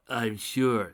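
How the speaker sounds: plain reading voice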